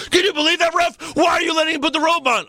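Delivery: high, fast whining complaint; whiny Barrett. Retold or imitated. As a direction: whine at the ref in a high voice. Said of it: "Barrett's like".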